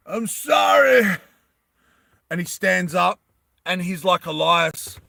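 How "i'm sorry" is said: high, loud, drawn-out apology; hammed-up cry. Exposed tent guy shouting apology, hammed-up wail. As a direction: wail it out slowly, overacted.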